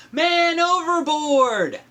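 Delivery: high, loud slow alarm cry; sailors' high alarm cry. Acted. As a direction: shout the alarm to the deck. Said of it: "the sailors cried".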